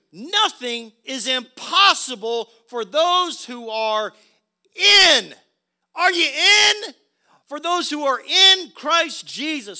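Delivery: loud, high, slow pulpit proclamation; emphatic preacher building to a shout; full-force preaching. Acted. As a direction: preach it out, drawing out the key words.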